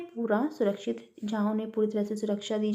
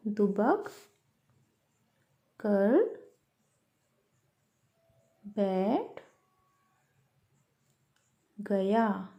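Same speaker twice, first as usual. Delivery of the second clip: slow dictation; 3 semitones lower, 5 dB quieter, much slower than usual. Shown as a demonstration. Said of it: "इसको हमें ठीक करके लिखना है".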